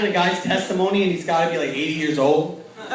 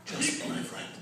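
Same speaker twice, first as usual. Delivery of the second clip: hushed calm voice; much slower than usual. Retold or imitated. Calm supervisor hushing the new intake, near-whisper. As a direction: breathe it like a serene guru.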